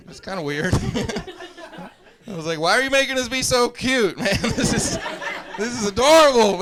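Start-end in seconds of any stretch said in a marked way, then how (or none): 2.6-6.6 high, loud, tickled gushing; mock-adoring squeal building louder; gushing delight. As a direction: play a recruit thrilled by the cuteness. Acted recruit gushing at instructor.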